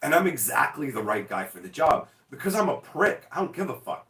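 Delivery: ordinary delivery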